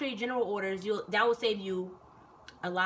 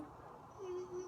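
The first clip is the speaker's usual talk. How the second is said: tiny, high, soft hum; retold timid little mm-hmm; meek mumbled assent; 9 semitones higher, 17 dB quieter than usual. Shaking recruit answering her sergeant, meek high hum.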